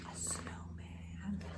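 a soft whispered reveal. Real, not acted. Showing children the snowman, whispered. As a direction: whisper it like a secret surprise.

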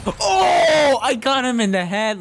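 high, loud burst of triumph; excited shout. Real, not acted. Gamer hitting a headshot, shouting with excitement. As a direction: whoop at the headshot.

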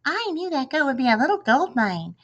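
high, lilting mock of her voice; mocking mimicry of Katniss. A narrator's reading. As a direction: tease her by aping her words. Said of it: "He begins to mimic me".

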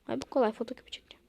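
fast whisper to herself; quick whispered aside. Acted. Employee whispering a quick aside to herself. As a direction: rattle it off in a whisper.

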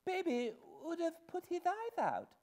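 put-on very high small-child voice; lisping little girl. Little Jane explaining about baby, lisping child. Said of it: "lisped the little girl".

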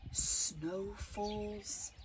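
plain reading voice

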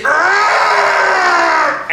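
long high-pitched roar; imitated angry scream. Son imitating dad screaming in his face, drawn-out roar. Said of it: "screamed in my face".

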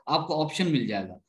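ordinary delivery

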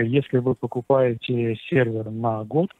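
usual speaking voice

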